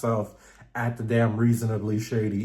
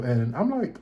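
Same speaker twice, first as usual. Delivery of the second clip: usual speaking voice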